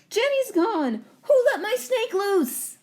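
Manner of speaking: high, loud panicked cry; shouting in alarm. Acted. Emily shouting to the class that her snake escaped. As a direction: yell it across the classroom. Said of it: "Emily shouts".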